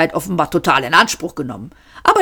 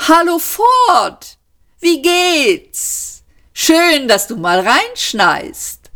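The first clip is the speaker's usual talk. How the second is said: much higher, slow, overdone casual greeting; fake nonchalance; 11 semitones higher, much slower than usual. A narrator's reading. Sayford greeting Ford with hammed-up fake casualness. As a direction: act terribly casual. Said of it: "mit furchtbar geheuchelter Lässigkeit".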